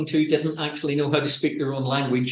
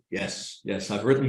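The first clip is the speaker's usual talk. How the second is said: low, soft, relaxed voice; dropped low voice; 6 semitones lower, 3 dB quieter than usual. Acted. Author answering in a radio interview after a cough, low-voiced. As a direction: let the voice sink deep after coughing. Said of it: "your voice drops about an octave".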